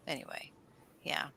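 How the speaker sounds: usual speaking voice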